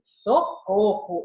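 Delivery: very slow, mocking "Socorro!" without pitch rise; ironic cry for help. Shown as a demonstration. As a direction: drawl "Socorro!" sarcastically. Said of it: "A outra fez assim".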